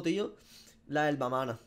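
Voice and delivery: ordinary talk, nothing marked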